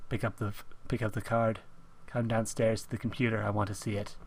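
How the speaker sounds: soft, low mock phone voice; imitated hushed demand. Imitating friend phoning about the card, hushed demand. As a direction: imitate a caller's hushed orders. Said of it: "you're just gonna be like".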